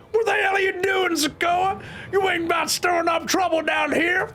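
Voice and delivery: very high, shrieky American character; hammed-up high-pitched yelling; shouting American character. Voicing an angry game character.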